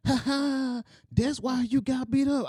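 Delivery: high sing-out playground taunt, imitated; mocking girl. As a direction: imitate a little girl gloating. Girl taunting him in the story.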